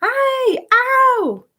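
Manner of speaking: high, loud cries of pain. A narrator's reading. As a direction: howl like a tortured giant. Giant in nightmare pain.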